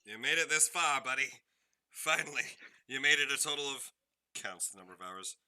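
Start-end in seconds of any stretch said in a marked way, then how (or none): 0.0-3.9 loud, high congratulation; playful, full-volume announcement; loud cheering. Real, not acted. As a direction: call it out big and high to a buddy. Game master cheers end of session.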